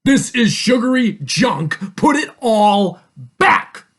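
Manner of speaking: loud, high, slow telling-off; scolding father. A narrator's reading. Father makes Tia put the chocolate back, exasperated scolding. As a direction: punch each word, stretching the order.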